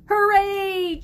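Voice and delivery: high, loud happy cheer. A narrator's reading. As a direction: cheer the dogs on. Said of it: "cheered Ben".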